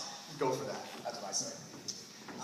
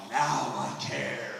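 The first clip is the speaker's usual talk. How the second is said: deadpan; 7 semitones lower, much slower than usual. Pretend. Deadpan punchline repeated.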